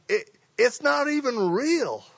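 usual speaking voice

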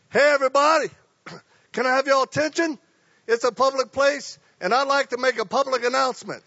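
high, loud announcement to the whole library; public announcement shout. Retold or imitated. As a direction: call out to everybody, loud and high. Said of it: "I felt like I had a God-inspired idea".

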